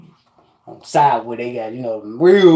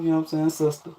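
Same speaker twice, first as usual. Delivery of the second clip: ordinary talk, nothing marked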